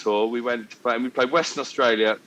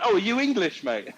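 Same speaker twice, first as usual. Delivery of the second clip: higher-pitched feigned curiosity; mock-innocent question; 7 semitones higher than usual. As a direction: ask it as bait, all innocence. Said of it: "And so I went".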